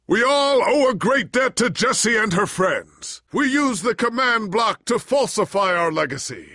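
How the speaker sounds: high, loud orator's address; public speech. Acted. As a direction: address the assembled crowd.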